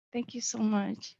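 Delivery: ordinary talk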